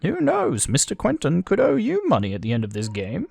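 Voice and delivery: high-pitched character voice; high, theatrical game dialogue. Acted.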